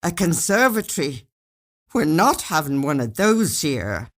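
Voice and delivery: irritated husband protesting; indignant husband. A narrator's reading. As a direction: push back hard, exasperated. Husband refusing, annoyed.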